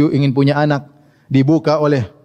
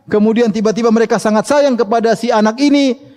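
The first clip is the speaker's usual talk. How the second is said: ordinary delivery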